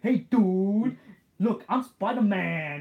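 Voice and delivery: high, loud, slow dopey bystander; goofy dude voice. Retold or imitated. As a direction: stretch it out like a proud doofus. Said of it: "Rodney did that voice".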